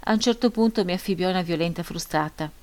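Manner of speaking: usual speaking voice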